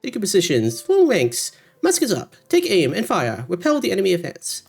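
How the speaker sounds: high-pitched military orders turning to recruitment pitch; drill officer, then event announcer. Acted. Streamer voices musket event text, commanding announcer in high pitch. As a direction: call out the orders, pitched well up.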